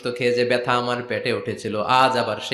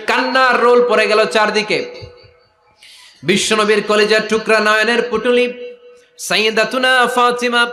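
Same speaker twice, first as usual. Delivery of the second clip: high, ringing sermon chant; impassioned preaching; 10 semitones higher, 3 dB louder than usual. Acted. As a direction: proclaim the grief in a raised preacher's chant. Preaches the weeping scene.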